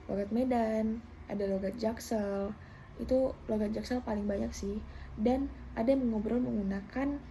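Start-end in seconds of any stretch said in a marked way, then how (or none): none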